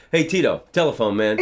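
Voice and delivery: put-on a twelve-year-old giving up on the Spanish and shouting it plainly; kid's blurted call. Boy calling his uncle to the phone, eager and loud.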